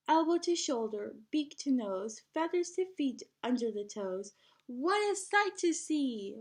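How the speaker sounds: lively storybook read-aloud; singsong picture book reading; reading to children. Acted. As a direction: read it like story time for a class. Teacher reads picture book aloud.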